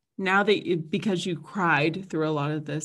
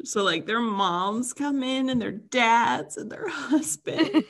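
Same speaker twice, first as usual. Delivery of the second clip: slow, breaking into laughter; laughing while talking; 6 semitones higher, 3 dB louder, slower than usual. Real, not acted. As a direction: giggle through the words. Describing Survivor family reunion.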